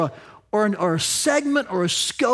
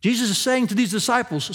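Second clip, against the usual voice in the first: ordinary talk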